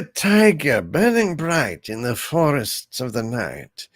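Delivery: slow, drawn-out poetry recital; stately impersonated voice, lingering on the words; James Mason. Acted. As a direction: savor each line slowly and theatrically. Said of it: "impression of James Mason".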